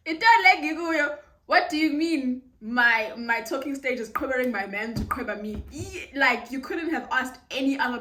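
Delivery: mock-furious what do you mean; outraged. Real, not acted. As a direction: vent the outrage loudly. Outraged retelling.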